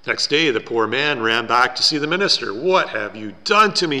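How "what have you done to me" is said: loud, higher-pitched outcry of protest; distressed cry. A narrator's reading. Poor man running back to the minister, crying out in distress. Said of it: "he cried".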